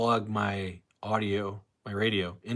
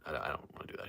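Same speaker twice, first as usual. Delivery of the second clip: whisper; 6 semitones lower, 10 dB quieter, faster than usual. Real, not acted. Host on live stream refusing to repeat the slur, whispered. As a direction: whisper it so nobody hears.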